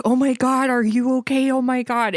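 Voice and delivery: high, fast mock panic; imitating frantic friends; panicked friends. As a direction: gush like a worried friend. Friends panicking over her eye.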